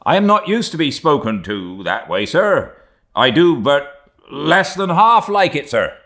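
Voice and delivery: high, loud, affronted retort; excited protest. A narrator's reading. Second mate answering his scornful captain, excited protest. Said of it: "then said excitedly".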